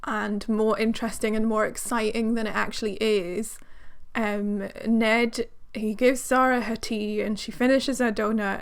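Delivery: ordinary talk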